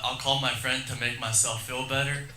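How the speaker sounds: usual speaking voice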